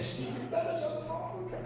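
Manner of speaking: tiny, very high, hushed squeal; mock starstruck squeal. Fan announcing a phone call from his idol, hushed high squeal. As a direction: squeak it softly like a thrilled fan.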